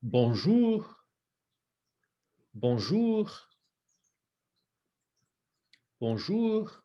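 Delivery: "Bonjour" with a full rounded O; round O shape. Shown as a demonstration. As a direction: open into a nice round O on "Bonjour". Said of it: "you want that nice O shape".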